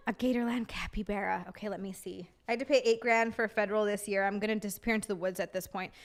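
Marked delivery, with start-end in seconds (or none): none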